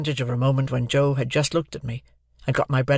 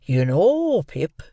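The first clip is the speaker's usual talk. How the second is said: higher, louder solemn Joe; solemnly; 3 semitones higher, 4 dB louder, much slower than usual. A narrator's reading. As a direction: say it gravely as Joe. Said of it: "said Joe solemnly".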